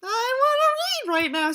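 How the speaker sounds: high, whiny mock cry; mock whine. Real, not acted. Tired reader whining to the audience, high mock cry. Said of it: "so I'm a little tired".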